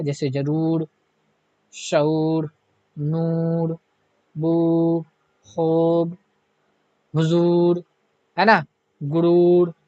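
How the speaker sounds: long drawn ऊ in जरूर, नूर, बू, खूब, गुरूर; long vowel. Shown as a demonstration. Teacher lists examples for students, stretching the long ऊ. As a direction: stretch the long ऊ in each word. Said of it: "खींच के जो उस वाव को पढ़ी जाती है".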